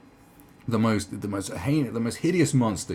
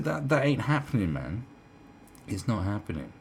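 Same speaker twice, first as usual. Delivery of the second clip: usual speaking voice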